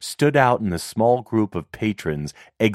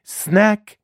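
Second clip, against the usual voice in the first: calling out; 14 semitones higher, 4 dB louder than usual. Acted. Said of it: "she called out".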